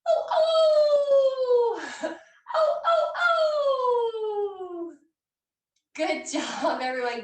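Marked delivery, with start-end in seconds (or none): 0.1-4.9 high loud coyote howls; coyote yips. Shown as a demonstration. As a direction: howl it out like a coyote. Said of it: "let's sound like a coyote".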